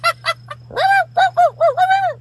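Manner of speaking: loud high burst of laughter. Real, not acted. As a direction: crack up way up high. Laughing at the impression.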